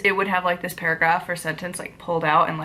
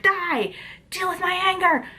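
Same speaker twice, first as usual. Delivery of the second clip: higher, hammed-up axe-wielder cry; mock angry yell; 10 semitones higher than usual. Vlogger pretending to attack a tree, mock angry. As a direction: playfully rage at the tree.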